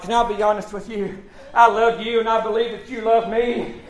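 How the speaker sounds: usual speaking voice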